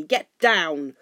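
loud, forceful bark; curt shouted dismissal; angry command. A narrator's reading. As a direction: snap the order out loudly. Thorin barks final order.

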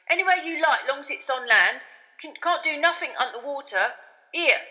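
loud, proud boast. A narrator's reading. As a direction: boast about the bus loudly. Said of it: "said Stan proudly".

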